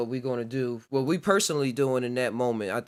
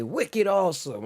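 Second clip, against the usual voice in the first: lightly imitated British accent, higher and slow; 6 semitones higher than usual. Fan quoting how the Brits talk, British accent. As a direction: draw it out like an admired Brit. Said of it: "love the Brits and how they talk".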